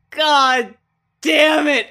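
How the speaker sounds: half-laughing loud cry of "God damn it"; loud exclaim. Retold or imitated. To his friend, remembering crying at the film, a loud laughing outburst. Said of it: "It was like".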